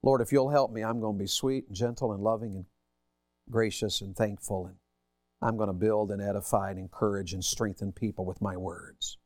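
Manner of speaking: soft, tender prayer voice; a quiet, humble vow to God; gentle prayer. Acted. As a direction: pray it softly and humbly. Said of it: "of saying".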